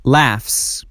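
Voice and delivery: "laughs" ending in a hissed s after f; voiceless s ending. Shown as a demonstration. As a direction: finish "laughs" with a crisp s, no buzz. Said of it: "S or E S is pronounced as s". («laughs» با س بدون لرزه)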